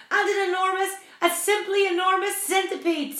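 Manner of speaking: high, loud, frantic captain; screamed. A narrator's reading. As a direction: scream it out in utter disbelief. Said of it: "screamed the captain".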